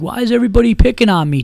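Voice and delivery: put-on high, loud, fast whiny drunk. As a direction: play the picked-on drunk. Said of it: "acting like".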